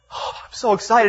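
high-pitched gush of joy; acted excitement. Hugging a stranger, high and thrilled. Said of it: "I'm just excited".

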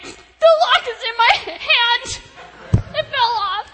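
high, loud, stretched-out wail; re-enacted helpless shout; exasperated yelling back. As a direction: holler the bad news through a door. Yells back to rescuer through door.

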